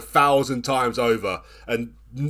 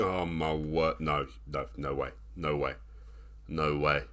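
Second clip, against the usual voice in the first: quiet despair; 9 semitones lower, 10 dB quieter, slower than usual. Real, not acted. Despair as match seems lost.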